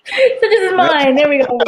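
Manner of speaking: high, loud words through real laughter; laughing. Real, not acted. Host laughing at co-host's rewording of her awkward phrase, laughing. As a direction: crack up while repeating the phrase slowly.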